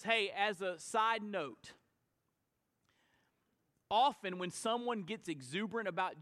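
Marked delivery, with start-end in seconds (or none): none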